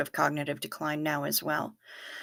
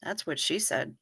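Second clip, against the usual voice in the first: deadpan; 7 dB quieter than usual. Retold or imitated. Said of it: "without missing a beat".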